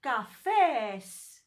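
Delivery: Cafes with a loud, drawn-out second syllable; stress on final syllable. Shown as a demonstration. Teacher showing where the stress goes, Cafes stressed hard on the end. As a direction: punch and stretch the "fes" in Cafes. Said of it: "I put the stress here".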